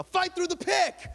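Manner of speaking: high, loud, drawn-out coach yell; a coach shouting a drill name at tryouts, very high and loud. Retold or imitated. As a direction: bark the drill call across the gym. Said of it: "Mister G would yell".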